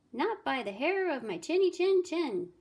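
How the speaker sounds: high, loud piglet voice; little pig's defiant refusal. A narrator's reading. Little pig answering the wolf through the door, defiant and high. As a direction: refuse in a high, bouncy storybook voice.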